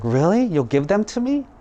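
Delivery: high, rising amazed question; delighted disbelief. Acted. Woodcutter is offered all three axes, surprised. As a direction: ask it in happy astonishment, pitch soaring.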